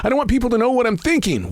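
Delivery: a hint of a whiny skeptic; mock naysayer. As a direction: poke fun at people who won't share their thoughts. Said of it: "People say".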